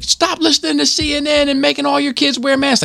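high-pitched stranger's rant; imitated angry yelling. Stranger ranting at parents about masks, imitated yelling. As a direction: rant in a high, mocking yell. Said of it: "it's this dude yelling at my wife".

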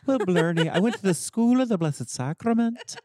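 put-on high, hammy Irish accent. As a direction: ham up a stage Irish accent. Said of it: "then you're doing an Irish accent".

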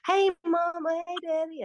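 high mock-Southern greeting; mimicked Southern dialect. Mother quoting her daughter back, high mimicked Southern accent. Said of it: "doing my Southern dialect for you".

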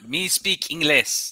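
slow, halting broken English; mock caveman English, slow; talking like a lost tourist. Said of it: "I even said that".

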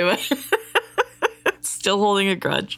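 ordinary talk, nothing marked

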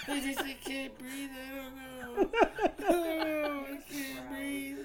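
hammed-up tearful whimper; mock crying. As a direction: imitate a girlfriend sobbing for breath. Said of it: "How the fuck are you crying".

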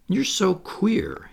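high, slow puzzlement; mildly baffled; frankly puzzled. A narrator's reading. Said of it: "frankly puzzled".

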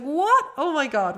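high, loud excited "What?"; delighted surprise. Retold or imitated. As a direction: burst out in happy disbelief. Delight at listeners' messages.

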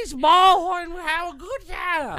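high, loud baseball announcer parody; hammed-up commentator holler; Harry Carey. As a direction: bellow it like an excitable sportscaster. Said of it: "I'm Harry Carey".

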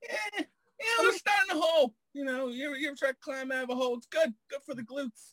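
high, strained put-on voice; comedian impression. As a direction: squawk it way up high.

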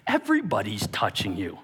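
ordinary talk

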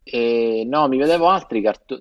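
ordinary talk, nothing marked

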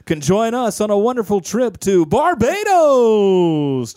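slow, loud prize announcement; game show host. Pretend. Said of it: "game show host voice".

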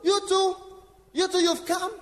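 devil mocking. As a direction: sneer it like the accuser. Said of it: "the devil will tell you".

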